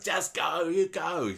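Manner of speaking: mock furious command; angry Ken. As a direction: order her out of the house. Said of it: "And he says".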